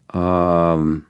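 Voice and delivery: long, level, drawn-out hesitation; musing hum, held; pondering. A narrator's reading. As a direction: stretch it out while thinking aloud. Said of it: "I pondered out loud".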